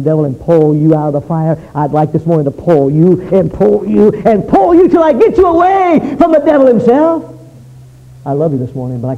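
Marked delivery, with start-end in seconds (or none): 1.7-7.2 preaching that builds to a high, loud cry; impassioned pleading. Real, not acted. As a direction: climb with each repeat, straining to rescue them. Preacher pleads to pull sinners free.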